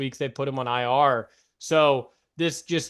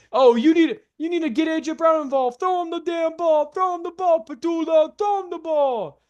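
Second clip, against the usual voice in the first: heckling Philly fans; 12 semitones higher than usual. Acted. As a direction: holler like an irate crowd demanding a pass. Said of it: "he's hearing all the Philly noise".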